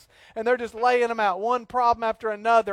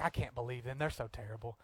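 much softer, lower conspiratorial grumble; mock commiserating; 8 semitones lower, 14 dB quieter than usual. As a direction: grumble along with your wife. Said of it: "I'm like".

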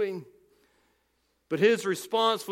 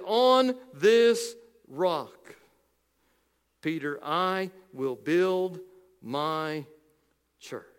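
each word of "On this rock, Peter, I will build my church" laid down very slowly; slow emphatic quote; 2 semitones lower, much slower than usual. Shown as a demonstration. Preacher slowly stressing the verse for his congregation. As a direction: read the verse out word by word so they listen carefully. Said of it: "listen to what Jesus says and listen carefully".

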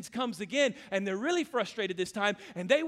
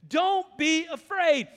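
higher, louder and slow rallying cry; injected with life; 7 semitones higher, 5 dB louder, much slower than usual. Acted. Preacher showing the life Nehemiah injects into his people, rallying. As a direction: pour life into it. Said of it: "Do you hear the life that he injects".

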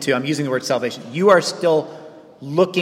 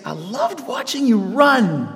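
tender parent; 5 semitones higher, slower than usual. Retold or imitated. What a parent would say to kid.

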